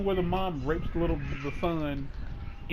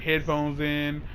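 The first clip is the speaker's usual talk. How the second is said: ordinary talk, nothing marked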